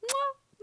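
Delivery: high, soft kiss noise; quick "mwah" smooch to the audience; blown kiss. Real, not acted. Vlogger saying goodbye to viewers, high blown kiss.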